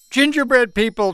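loud, high-pitched proclamation; dramatic announcement. A narrator's reading. Girl reveals who is at the door, dramatic announcement. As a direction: proclaim it, voice well up. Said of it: "Teresa announced".

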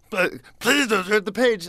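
high, crying Grover plea, way up in pitch; Grover pleading. Retold or imitated. Grover begs the reader not to turn the page, high and whimpering. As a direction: beg like scared Grover near tears. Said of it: "and he's crying".

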